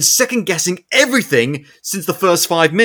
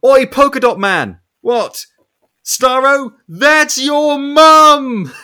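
high, loud mocking taunt; mock yell; 8 semitones higher, 5 dB louder, much slower than usual. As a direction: shout it out like a cheeky jeer, stretching the last words slowly. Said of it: "Bloodsport's just like".

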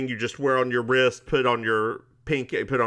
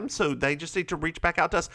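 usual speaking voice

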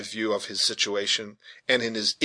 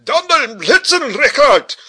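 high, loud, slow German outburst; agitated old German veteran, loud and drawn out; German-accented exclamation; 9 dB louder than usual. A narrator's reading. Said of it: "exclaimed the veteran German".

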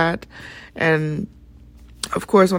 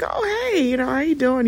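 bright greeting; 7 semitones higher than usual. Retold or imitated. Surprised friendly greeting.

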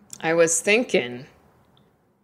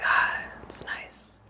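whisper; 10 dB quieter, slower than usual. Retold or imitated. Whispered reaction to music.

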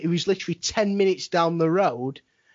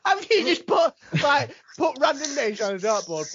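incredulous; 8 semitones higher than usual. Real, not acted. Teasing friend's random list.

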